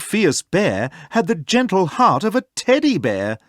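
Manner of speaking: ordinary delivery, nothing marked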